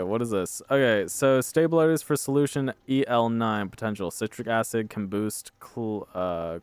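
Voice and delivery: ordinary delivery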